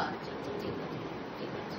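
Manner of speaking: barely voiced soothing okay; whispering. Real, not acted. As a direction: whisper it to settle her. Hushing the child to sleep.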